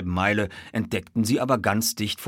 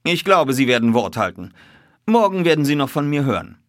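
higher, louder detective voice; assured detective; 7 semitones higher, 7 dB louder than usual. A narrator's reading. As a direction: close the deal with confidence.

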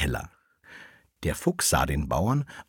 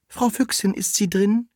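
very high cat calling at a door; high, squeaky cat messenger; maid cat; 13 semitones higher than usual. A narrator's reading. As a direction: call in pitched way up as the cat. Cat calls at vixen's door.

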